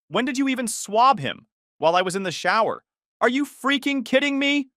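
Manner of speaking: wife screaming at her partner behind her back. Acted. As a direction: scream it at him in disbelief. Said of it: "She was screaming now".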